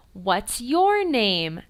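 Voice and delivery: very slow, clear question. Shown as a demonstration. Teacher asking a beginner student's name slowly. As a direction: draw the question out for the child. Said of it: "for example".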